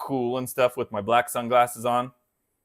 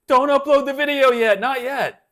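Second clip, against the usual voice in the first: mock urgent warning; 11 semitones higher, 6 dB louder than usual. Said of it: "it was like".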